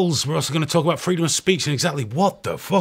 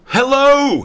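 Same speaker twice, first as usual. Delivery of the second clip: loud, high greeting shouted out; loud high hello; 12 semitones higher, 7 dB louder than usual. Shown as a demonstration. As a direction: belt out a big high hello. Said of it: "you gotta say hello to me".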